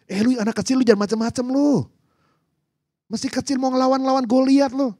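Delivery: put-on high, scornful scolding of a kid. Saul telling the little boy off for challenging Goliath, scolding. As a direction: scold him in a high, dismissive voice.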